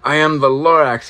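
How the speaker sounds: high, loud and sniffly; a hint of a wheezy Lorax; coughing Lorax. A narrator's reading. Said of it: "he coughed and he whiffed".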